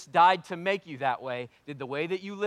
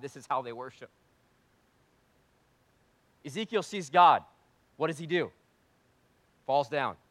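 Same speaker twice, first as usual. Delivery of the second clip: ordinary talk, nothing marked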